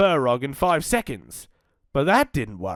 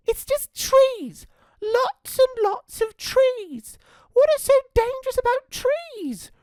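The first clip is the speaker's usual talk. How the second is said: put-on much higher, grumbling girl voice; sulky little girl Red; 4 dB louder than usual. Red complains to Arthur about Mum, high sulky girl voice. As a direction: complain to the cat. Red's voice in story.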